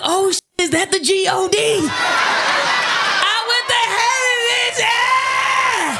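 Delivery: high, exultant whooping; jubilant shock. Acted. Driver waking, thinks she made it to heaven, jubilant. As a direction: draw it out high, like rejoicing in heaven.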